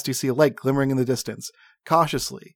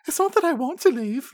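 high, hesitant halfling voice; hedge, not sure of yourself; uncertain halfling; 12 semitones higher than usual. A narrator's reading. Halfling uncertainly answering Carrick. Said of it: "Laura says uncertainly".

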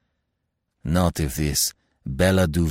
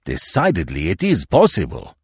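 rising, louder French conviction; excited; 6 dB louder than usual. A narrator's reading. Commissary agreeing more firmly, excited. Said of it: "the commissary cried excitedly".

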